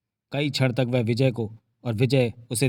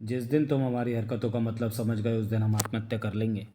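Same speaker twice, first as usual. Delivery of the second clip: softer retake; 6 dB quieter than usual. A narrator's reading. Narrator restarts the line.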